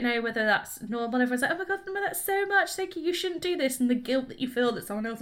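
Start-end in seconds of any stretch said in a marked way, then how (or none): 1.5-3.8 high-pitched mock gushing over a gift; gushing thanks. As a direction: act out polite, overwhelmed protest. Acting out effusive gift thanks.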